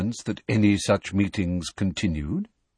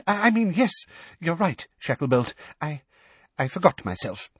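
flustered; 6 semitones higher than usual. A narrator's reading.

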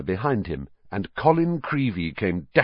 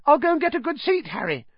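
much higher, fast piping call; faster than usual. Acted. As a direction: call out after him like an excited little boy. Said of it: "Colin called after him in a piping voice".

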